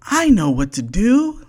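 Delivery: high, perky little bird voice; small bird with a bright idea, high up; bird's voice. A narrator's reading. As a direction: chirp it like a know-it-all bird. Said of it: "said the bird".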